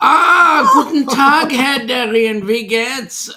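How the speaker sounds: loud, high hammed-up German accent. Welcoming Darien to Germany, hammed-up German accent. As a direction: greet him in a big mock German accent. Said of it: "I will speak with the accent only this once".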